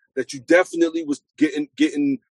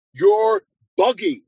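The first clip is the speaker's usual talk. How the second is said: drawn-out, higher-pitched callout; emphatic call-out; 3 dB louder, slower than usual. Retold or imitated. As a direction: stretch it slowly, pitched well up. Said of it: "somebody needed to say".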